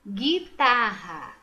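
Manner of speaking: "Guitarra" slow and loud, starting with hard gi; "Guitarra" enunciated loudly by syllables; careful sounding-out. Shown as a demonstration. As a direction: stretch "Guitarra" with silent U. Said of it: "também não".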